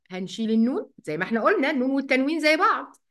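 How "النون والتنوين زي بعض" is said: ordinary delivery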